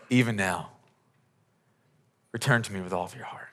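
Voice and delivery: very soft, tender plea; almost whisper it as God's longing. Acted. God speaking to his people, a soft plea.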